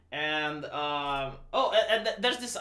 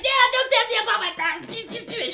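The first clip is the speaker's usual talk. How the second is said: high, loud mocking whine of Rey; mock angry Rey; 13 semitones higher, 4 dB louder than usual. Mocking Rey refusing to hear about her parents, high whiny voice. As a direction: parody her petulant refusal. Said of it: "She gets angry".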